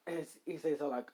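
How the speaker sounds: plain reading voice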